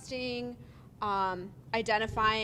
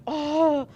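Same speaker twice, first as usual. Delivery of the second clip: loud, higher exasperated "oh"; imitated frustrated groan; 5 semitones higher, 10 dB louder than usual. Teacher retelling a student asked to repeat, imitated frustrated groan. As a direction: mimic a fed-up student's groan. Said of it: "she was just so frustrated".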